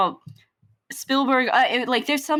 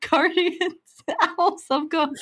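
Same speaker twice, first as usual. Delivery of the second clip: laughing; 7 semitones higher, much slower than usual. Real, not acted. Jokingly mangled film title.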